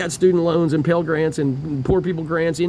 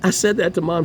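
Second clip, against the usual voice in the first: ordinary talk, nothing marked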